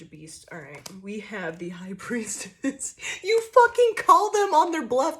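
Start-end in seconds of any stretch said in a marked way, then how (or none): none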